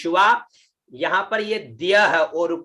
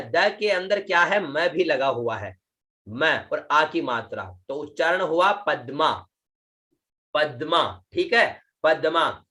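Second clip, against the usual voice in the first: ordinary talk, nothing marked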